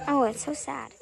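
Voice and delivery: ordinary talk, nothing marked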